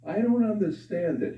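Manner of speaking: high-pitched puzzled grumble; complaining. Acted. Husband to the doctor at medical exam, complaining. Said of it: "the husband is complaining to the doctor".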